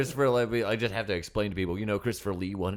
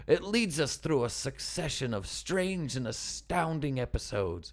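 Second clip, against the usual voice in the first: higher, slow grand reading; dramatic reading voice; 6 semitones higher, slower than usual. Pretend. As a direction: savour it like a storyteller. Reading review in put-on voice.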